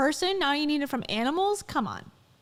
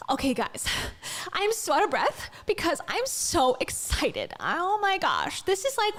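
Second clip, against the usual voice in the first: mock breathless, over-excited shopping-channel pitch; imitated QVC host, hyped-up; Rachel Hollis's sales voice; 4 semitones higher than usual. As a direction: gush as if out of breath with excitement to sell. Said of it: "I have something to sell you".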